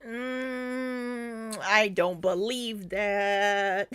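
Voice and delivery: long doubtful hum, then drawn-out words; openly sceptical. Real, not acted. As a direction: stretch it out slowly in disbelief. Skeptical reaction to a played claim.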